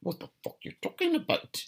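botched attempt at a British accent; Brayden Bradley accent. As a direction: try a British accent, high and strained, and miss. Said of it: "That's my, uh, Brayden Bradley accent".

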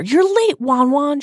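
put-on high, pouty girlfriend complaint; high-pitched sulky reproach; pouted. A narrator's reading. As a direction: whine it lightly through a pout. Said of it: "she pouted".

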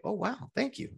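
grateful surprise at a tip; surprised thanks. Retold or imitated. Receiving unexpected tip.

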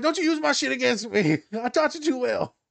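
high, laughing mock-indignant; to the therapist, high and laughing; sassy retort. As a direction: protest with a laugh and attitude.

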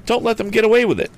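high, fast urgent cry. A narrator's reading. Tom urges friends after the box, fast high cry. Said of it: "cried Tom".

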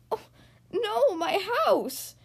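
high, loud, drawn-out wail; dismayed yell. Acted. Beaver watching his house collapse, yelling in dismay. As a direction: stretch out an acted cry of dismay. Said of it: "yelled Beaver".

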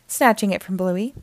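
usual speaking voice

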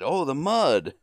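high, loud mock excitement; hammed-up excited exclamation, pitch leaping up. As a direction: imitate an excited stooge calling out. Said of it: "and he's excited".

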